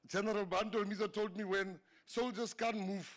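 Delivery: ordinary talk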